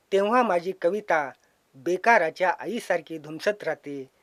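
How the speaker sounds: ordinary talk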